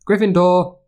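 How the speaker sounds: high, ringing announcement of the house; Sorting Hat call. A narrator's reading. The hat calling out the new student's house.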